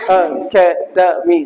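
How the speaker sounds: four separate slow beats on "अकेडमी"; syllable by syllable. Shown as a demonstration. Teacher showing the chin-tap shortcut, "अकेडमी" in four slow separated syllables. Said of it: "बिना rule के भी आप बता सकते हैं इसमें कितने syllable हैं".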